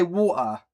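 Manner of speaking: "water" with the T dropped. Shown as a demonstration. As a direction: say "water" with a glottal stop for the T. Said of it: "With no T".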